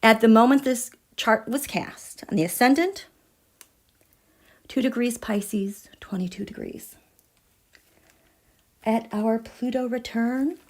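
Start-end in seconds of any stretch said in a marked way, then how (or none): 4.7-7.0 hushed, breathy whisper of awe. Real, not acted. Whispering the chart degree to her viewers in awe. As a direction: whisper it like a secret.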